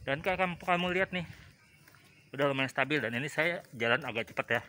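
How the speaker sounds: muffled behind a thick mask; through a face mask. Real, not acted. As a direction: talk through a two-layer mask. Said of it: "ini saya pakai masker, jadi suaranya agak ketutup ya".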